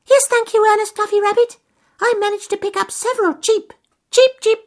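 very high, fast little bird; chirpy bird voice. Acted. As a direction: chirp it down from the treetops. Sparrow answers.

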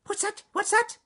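tiny, extremely high voice; wheezy spider. Acted. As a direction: pipe up with an inquisitive wheeze. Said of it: "wheezed Jones the Spider".

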